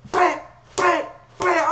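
bam bam bam yelled high and loud like blows landing; shouted hit sounds. Retold or imitated. A kid mimicking his dad's shoe strikes, shouting.

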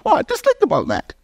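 climbing pulpit fervor; full-force preaching. Acted. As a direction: lift the room, preach it out.

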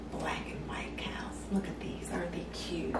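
soft breathy whisper, almost no voice. Real, not acted. Whispering to viewers over her cute little cow shakers. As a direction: lean in and whisper over something adorable.

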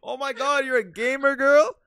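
loud, high excited rising; excited gush. Real, not acted. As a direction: gush over the discovery. Excited at a gamer girl.

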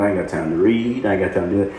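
low, soft mock excuse; mumbled-down imitation of someone making excuses. Preacher imitating a believer too busy for God, low mock excuse.

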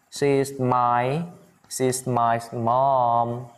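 very slow, drawn-out words; slow model phrase. Shown as a demonstration. Teacher models an answer for students, very slowly. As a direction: stretch each word out for learners.

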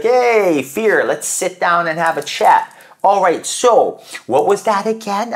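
bold challenge to fear. Retold or imitated. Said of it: "sit down and be like".

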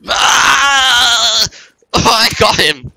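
high, loud burst of surprise; excited shout. Real, not acted. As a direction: yelp then cheer. Sudden kill in game.

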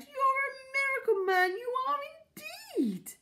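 high, loud gush of praise; high-pitched, overjoyed acclaim; delighted cry. A narrator's reading. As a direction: cry out in sheer admiration. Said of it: "Missus Silver cried".

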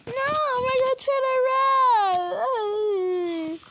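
put-on child crying protest. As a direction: bawl it out like a punished kid.

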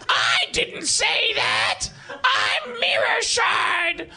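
high slow screech; shrieking monster. Acted. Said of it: "looks over his shoulders ... and says".

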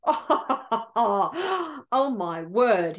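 salivating king gloating in awe; chuckle, then savor it slowly; greedy delight. Acted. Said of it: "he is just salivating by now".

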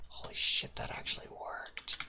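awed whisper of disbelief; stunned whisper. Real, not acted. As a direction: breathe it out, amazed it worked. Amazed the trick worked.

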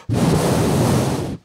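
long unvoiced blow of air; blowing air to cool a burn; cooling breath. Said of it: "I'm trying to cool it off".

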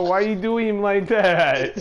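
laughing while talking. Real, not acted. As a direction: say it through laughter. Laughing at the defeat.

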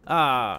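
short sharp startled cry; startled yelp. Real, not acted. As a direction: jump at a scare. Startled in game.

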